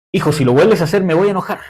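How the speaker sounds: warning the son. Retold or imitated. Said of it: "Le dije".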